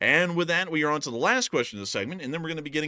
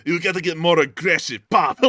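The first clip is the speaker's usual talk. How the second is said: louder coaxing voice; urging the dog; 4 semitones higher, 7 dB louder than usual. Retold or imitated. Putin egging the dog on, louder coaxing. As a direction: egg the dog on to act tougher. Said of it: "He's like".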